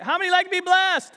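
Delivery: loud, high call to the congregation; rally the room with the question; preacher's call out. Real, not acted. Preacher rousing the congregation, loud call. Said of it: "say it like you mean it".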